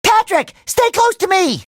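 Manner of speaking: high, anxious call; alarmed urging. Acted. Hero keeps Patrick close, high alarmed urging. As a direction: urge your friend close in alarm. Scared, warning friend.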